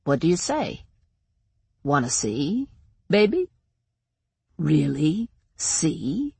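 soft, teasing menace, drawn out at the end; grinning taunt. A narrator's reading. Shadow Man tempts the blind girl with sight, grinning softly. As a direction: tempt slowly with an audible grin. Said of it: "I could almost hear him grinning".

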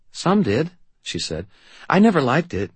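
put-on awkward uncertain girl; young girl voice. Sarah's voice.

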